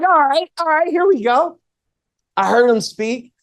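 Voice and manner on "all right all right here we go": hyped up. Retold or imitated. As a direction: fire up the room. Hyping up after teasing.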